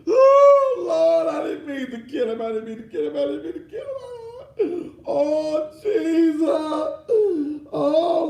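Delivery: hammed-up wailing, high and loud; fake-cry the killer's apology to the court; mock sobbing confession. Defendant putting on tears for the jury, mock sobbing.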